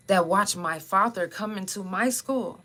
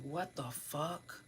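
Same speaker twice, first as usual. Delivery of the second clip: stunned hush; 2 semitones lower, 16 dB quieter, much slower than usual. Retold or imitated. Friend sees the blood.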